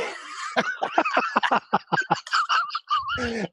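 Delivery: high whooping burst of laughter; laughing shout. Real, not acted. Telling the cousin story, bursting into a whooping laugh.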